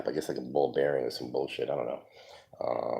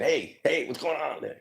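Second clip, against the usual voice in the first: yelled warning; 12 semitones higher than usual. Retold or imitated. Stranger catching teens smoking under the stairs, shouting. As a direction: call out like a caretaker catching kids. Someone yelling at hidden smokers.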